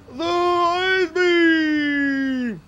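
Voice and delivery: very high, very slow yelled "ice beam"; drawn-out shout. Pretend. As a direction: stretch the name into a cry. Builds up to a yell.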